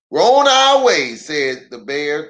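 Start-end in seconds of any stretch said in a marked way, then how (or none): 0.1-1.2 high, louder announcement; Papa's voice. Acted. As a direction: tell the neighbors the plan. Papa announces trip.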